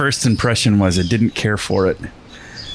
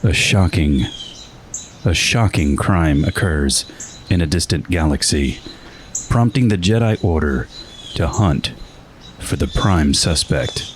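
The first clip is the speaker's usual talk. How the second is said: grand booming space narration; 4 semitones lower than usual. Pretend. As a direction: read it like a movie trailer. Dramatic reading of episode summary.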